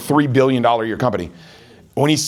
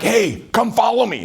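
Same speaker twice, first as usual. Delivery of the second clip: pushy pitch; 7 semitones higher, slower than usual. Pretend. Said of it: "It was not like".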